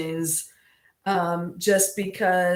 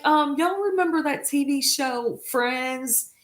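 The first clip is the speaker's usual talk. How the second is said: usual speaking voice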